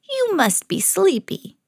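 high, slow, coaxing sweetness; gentle old woman. A narrator's reading. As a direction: lull them gently in a high storybook voice. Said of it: "who had seemed so gentle".

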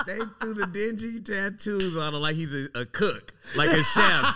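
high, laughing through words; giggling while teasing. Real, not acted.

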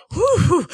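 high breathy exhale of relief; relieved whew. Pretend. Bianca reacts to her mother welcoming Joe, relieved whew.

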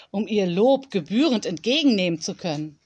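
usual speaking voice

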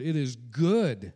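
slow, emphatic good; good drawn out with full weight; stress on good. Shown as a demonstration. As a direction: lean hard on good and stretch it. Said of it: "I emphasize that word".

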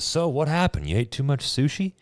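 a hint of a breezy doctor asking; quoting the doctor. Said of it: "That came out of her mouth".

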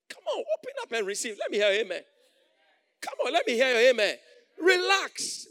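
loud rising call for amen; preaching at full force. Real, not acted. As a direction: rouse the church to answer. Preacher urging congregation response.

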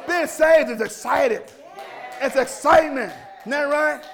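loud, high, impassioned preacher; fired-up pulpit shout, key words stretched; full-force preaching. Real, not acted. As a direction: rouse the congregation with shouted exhortations.

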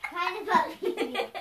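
slow, laughing through it. Pretend. Repeats while laughing.

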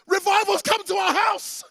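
high, loud revival shout; retold ecstatic announcement at full pitch; excited calling out. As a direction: holler it through the house. Excited father calls out revival.

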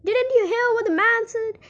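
high-pitched scolding call. A narrator's reading. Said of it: "called out Miss Gloop".

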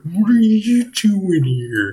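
high, slow, stretched whine; drawn-out Stuart voice. Shown as a demonstration. Coach demonstrates the drawn-out Stuart whine. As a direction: draw each word out with wiggling lips. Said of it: "Draw it out".

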